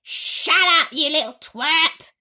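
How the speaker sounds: loud, high angry snap; snapping. A narrator's reading. Aunt snaps at the boy who overheard, angry. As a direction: bite his head off. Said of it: "Aunt Spiker snapped".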